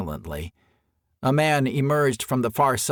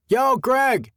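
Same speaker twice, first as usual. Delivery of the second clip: much higher, louder call from afar; 13 semitones higher, 4 dB louder than usual. A narrator's reading. Steve calling to Greg from outside the brush, calling out. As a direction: call across the brush to your friend. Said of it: "Steve's voice called from outside".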